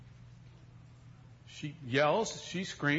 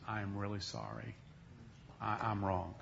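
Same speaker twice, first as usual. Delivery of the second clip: much softer, lower sincere apology; 7 semitones lower, 11 dB quieter than usual. Retold or imitated. Wife apologizing after a kiss, hushed and low. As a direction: admit it tenderly.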